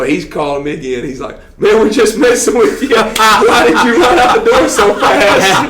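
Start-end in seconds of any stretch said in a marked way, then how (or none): 1.6-5.7 high, loud, laughing through the words; laughing mock taunt. Friend laughing at the prank victim. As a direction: crack up while teasing him.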